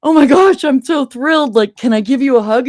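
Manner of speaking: son's gushing thanks, high and loud; imitating an overjoyed young man offering a hug; thrilled gush. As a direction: burst out with the son's giddy joy. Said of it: "and he was like".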